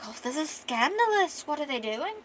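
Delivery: high-pitched mock-shocked onlookers; hammed-up scandalised bystanders; scandalised crowd. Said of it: "Everybody's like".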